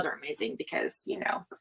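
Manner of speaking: conspiratorial whisper. Real, not acted. Confiding to chat about the melts, whispering. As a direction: share it as a secret.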